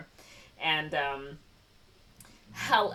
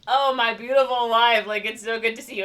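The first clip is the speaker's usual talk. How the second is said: loud, higher hammed-up husband; mock gushing greeting; 4 semitones higher, 6 dB louder than usual. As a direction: greet with big fake delight. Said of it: "he's like".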